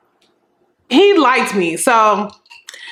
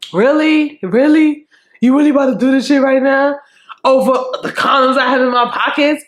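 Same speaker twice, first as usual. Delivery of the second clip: exasperated and incredulous, a little fast; exasperated disbelief; 3 semitones higher than usual. Retold or imitated. Boy exasperated by her argument.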